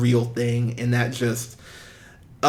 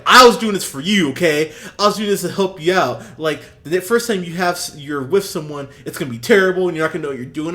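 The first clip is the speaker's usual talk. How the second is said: mock berating; 3 semitones higher, 7 dB louder, faster than usual. The boy berating the girl who refused him, loud and angry. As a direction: lay into her like a bully. Voicing the boy ripping into her.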